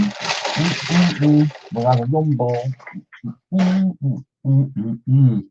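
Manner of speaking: high delighted mm sounds while eating; savouring a treat with happy hums; pleased humming. Real, not acted. Eating a rum ball on camera, delighted humming.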